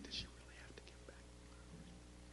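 breathy whispered plea from a hesitant neighbor. Retold or imitated. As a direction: whisper it as if asking a favor. Neighbor mom pleads over flowers.

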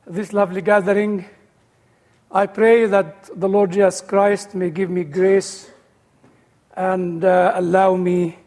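ordinary talk

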